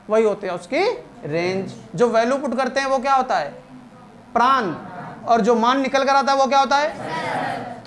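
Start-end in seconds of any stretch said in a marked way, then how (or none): none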